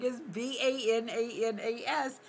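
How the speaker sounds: put-on spelling it like the [name] song; spelled out. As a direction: spell out "B A N A N A S" letter by letter. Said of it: "and I'm like".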